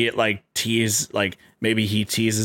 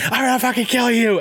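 Miss Piggy rage; 12 semitones higher, much faster than usual. Retold or imitated. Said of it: "she's like".

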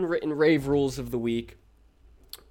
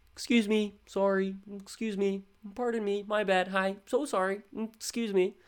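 polite apologetic voice; 7 semitones higher than usual. Shown as a demonstration. To pushy festivalgoers, a high meek polite apology. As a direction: lilt each excuse me up high like a meek apology.

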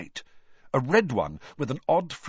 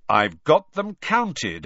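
slow, louder stern warning; 5 semitones higher, 6 dB louder, slower than usual. A narrator's reading. Charlie warning Hagrid off the eggs, stern. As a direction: say it slowly as a firm warning. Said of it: "said Charlie sternly".

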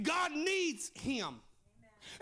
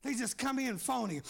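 ordinary talk

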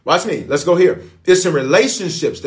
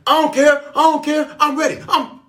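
defiant careless voice; 12 semitones higher than usual. Retold or imitated. Preacher voicing a reckless sicken attitude, high defiant mock. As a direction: toss it off like a reckless hothead. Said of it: "A sicken attitude says".

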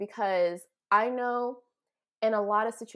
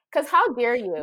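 high, loud mock indignation; playful outraged scolding; indignant; 4 semitones higher, 8 dB louder than usual. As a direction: throw it out as an offended challenge. Imagined angry comeback to Shaq.

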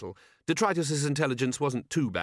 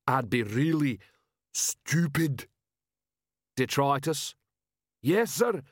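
dim troll, slightly higher; slow-witted troll sergeant; 3 semitones higher, slower than usual. Acted. Troll sergeant asking his commander, dim troll voice.

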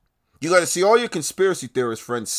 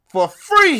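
loud, high punch on "For free"; "For free" shouted high and loud; shouted emphasis; 10 dB louder than usual. Real, not acted. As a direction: yell "For free" to drive it home. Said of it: "repeats "for free" louder".

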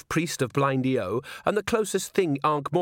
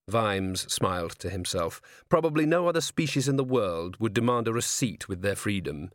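usual speaking voice

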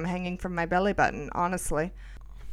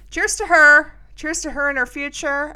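loud toast; 8 semitones higher, 8 dB louder than usual. Real, not acted. Toasting her assistant.

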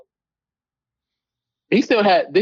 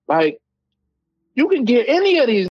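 normal talking voice

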